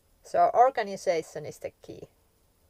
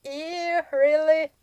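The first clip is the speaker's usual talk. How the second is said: high-pitched, mock-disgusted "ew"; disgusted reaction; 8 semitones higher, 4 dB louder, slower than usual. Someone hearing you live in a camper van, mock disgust. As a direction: recoil in high, exaggerated disbelief. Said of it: "it's like".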